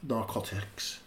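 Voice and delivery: narcotics said slowly and low, in an odd way; "narcotics" in a slow, hushed, weird delivery; oddly drawn-out word. Real, not acted. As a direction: stretch out "narcotics", dropping it soft and low. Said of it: "I loved how you said".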